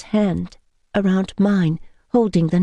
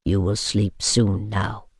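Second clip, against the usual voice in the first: low, softer, slow reassurance; low, slow soothing; 11 semitones lower, 5 dB quieter, slower than usual. A narrator's reading. Vampire lulls the woman, soothing slowly. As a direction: lull her toward sleep.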